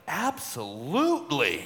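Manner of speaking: slow, drawn-out imitation of a delighted wife; lit-up delight. Wife lights up at husband's request, imitated delighted agreement. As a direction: stretch it out, imitating her lighting up. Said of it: "she lit up. She's like".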